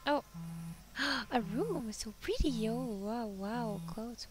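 normal talking voice

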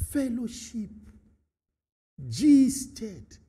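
ordinary talk, nothing marked

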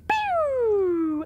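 playful zap noise; quick cartoon laser pew; laser pew sound. Testing the steering controls.

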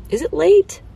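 high, loud startled question; a high loud double take on the season; sudden surprise. Real, not acted. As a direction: catch yourself mid-thought, surprised. Questions herself about the season.

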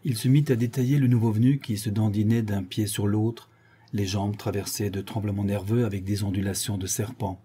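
ordinary delivery

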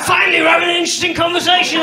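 finally, some real talk; relieved excitement. Retold or imitated. Dad thrilled his daughter makes sense, in a fast excited burst. Dad's excited inner thought.

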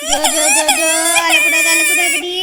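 high, loud hushing chant; loud baby-soothing. Acted. Grandmother hushing crying baby, loud singsong soothing. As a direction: coo loudly over the baby's wails.

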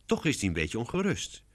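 ordinary delivery

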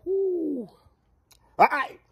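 ordinary delivery

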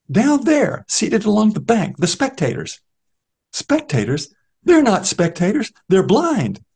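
high, scornful explanation; the beggar tells Newman the spectators are blind; bitter blind beggar. Acted. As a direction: correct him with a bitter edge.

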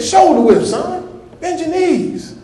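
high, slow mock coach; preacher imitating his football coach, high and slow. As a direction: play the old coach scolding a boy. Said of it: "He said".

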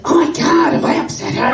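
high, loud squawk of alarm; worried Marge-like lady. Retold or imitated. Lady worries she upset Jenny, high and loud squawky fretting. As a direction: fret loudly in the Marge voice. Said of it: "related, I think, to Marge Simpson".

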